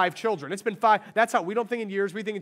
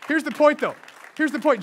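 loud, high preacher's point; preaching; 8 semitones higher, 7 dB louder than usual. Acted. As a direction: drive the point home to the congregation. Preaching climax.

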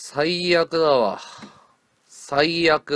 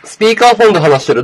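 repeating it louder and higher for the caller; 5 semitones higher, 8 dB louder, faster than usual. Real, not acted. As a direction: say it again, louder, so they hear. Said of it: "ん？".

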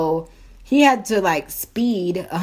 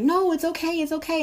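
reassuring onlookers; 8 semitones higher than usual. Retold or imitated. Coffee shop customers comforting the sick woman, reassuring. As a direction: reassure gently in a pitched-up voice.

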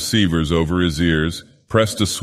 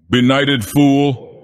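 slow, loud, higher shouted insult; 6 semitones higher, 3 dB louder, much slower than usual. Acted. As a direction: drag it out as a jeering shout. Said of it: "shouted the man".

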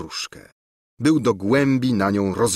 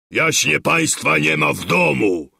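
louder, higher gruff announcement; booming surly footman; 6 semitones higher, 4 dB louder than usual. A narrator's reading. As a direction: boom it at the door like a rude lackey. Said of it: "huknął ... tubalnym, gburowatym głosem". (gburowaty lokaj hucząc przy drzwiach)

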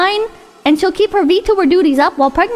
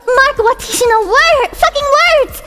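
high weeb voice; 6 semitones higher than usual. Acted. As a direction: rant it like an angry weeaboo. Reading weeb copypasta in voice.

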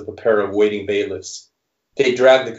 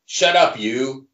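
gruff shout; 4 semitones higher, slower than usual. Acted. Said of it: "a deep male voice rings out".

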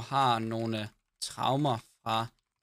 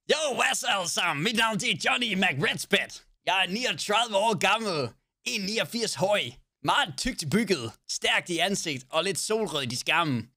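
put-on higher, slow Danish with a cowboy-style American accent; American accent character intro; 6 semitones higher, slower than usual. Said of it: "Skal vi snakke med amerikansk accent?".

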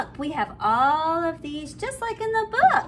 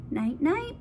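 ordinary delivery

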